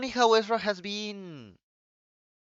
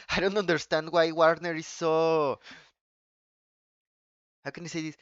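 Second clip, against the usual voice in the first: normal talking voice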